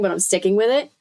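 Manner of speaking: ordinary delivery, nothing marked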